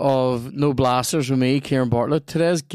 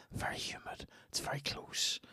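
hushed breathy whisper, voice dropped right away; whispered ad read; 18 dB quieter than usual. Acted. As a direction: whisper it like a secret ad.

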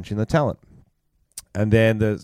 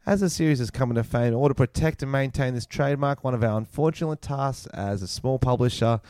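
higher, measured read-aloud voice; formal email reading; 4 semitones higher than usual. Acted. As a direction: read out an official letter formally.

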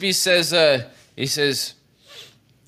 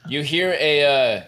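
usual speaking voice

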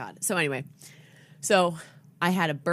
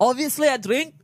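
put-on brash drunk grown-up; 6 semitones higher, 4 dB louder than usual. Drunk adult bit.

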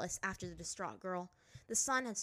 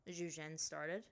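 plain reading voice

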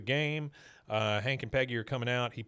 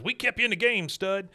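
lightly voiced character, pitched up; acted-out quote; 8 semitones higher, 4 dB louder than usual. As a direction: retell it as a high-pitched, punchy quote. Acted quote from the coach figure.

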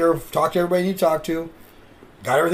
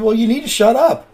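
higher, louder snide brush-off; heel attitude; 7 semitones higher, 6 dB louder than usual. Retold or imitated. Wrestling heel telling the fan to shut up, snide. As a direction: cut the fan down as the bad guy. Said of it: "playing a character".

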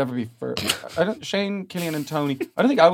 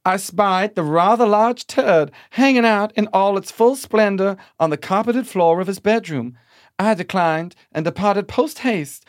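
Southern dame; 4 semitones higher than usual. Pretend. Host reading the maid story as a Southern dame. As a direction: read it like a scandalised Southern dame. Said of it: "Southern dame".

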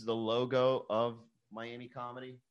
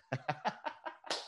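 high, breathy giggle; laughing; 4 semitones higher, 12 dB quieter than usual. Real, not acted. Host laughing at his own broken Spanish, giggling. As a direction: laugh softly at your own blunder.

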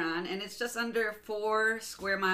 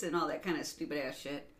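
ordinary talk, nothing marked

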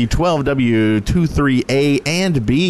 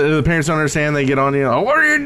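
put-on Canadian accent; faster than usual. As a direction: nag like a Canadian dad in a Canadian accent. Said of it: "Did you like my Canadian accent".

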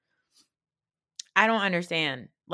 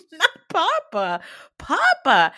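high, loud mock wail; Sanya; 10 semitones higher, 9 dB louder, slower than usual. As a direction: imitate a tearful cry, hammed up. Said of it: "She's crying".

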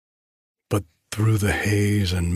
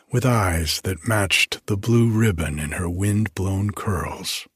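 plain reading voice

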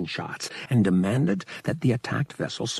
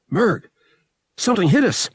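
high, loud alarmed call; sudden alarm as the ship is hit; 10 semitones higher, 4 dB louder than usual. Acted.

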